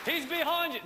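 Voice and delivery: high, loud warning yell at the stage; loud mock panto warning; panto crowd shout. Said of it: "the old audience shouting".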